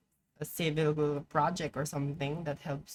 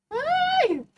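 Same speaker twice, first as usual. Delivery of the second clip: sudden high shriek of frustration; angry yelp; 7 dB louder than usual. Real, not acted. Ranting gamer bursting with a high yelp. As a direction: let out a sharp high cry. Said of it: "I'm getting angry thinking about it".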